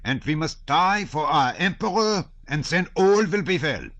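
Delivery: bang the table and swear it; old colonel vowing loudly to the guests; table-thumping vow. A narrator's reading. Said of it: "thumping the table".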